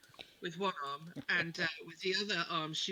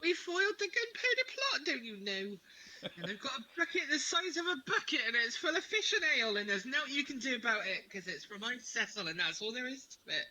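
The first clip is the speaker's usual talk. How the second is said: indignant; 4 semitones higher than usual. Acted. Shin kicker answering someone who doubts her identity, indignant. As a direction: protest with hammed-up outrage, starting high.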